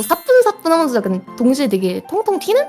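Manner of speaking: usual speaking voice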